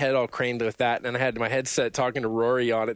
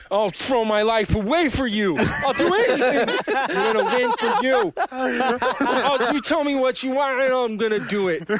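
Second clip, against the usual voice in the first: put-on very high, loud, cracking kid devotion; fawning Ralphie voice; 11 semitones higher, 4 dB louder than usual. Gamer swearing loyalty to the Valve dev in a squeaky kid voice. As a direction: pledge your life like a starstruck child, laughing.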